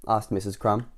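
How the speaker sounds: usual speaking voice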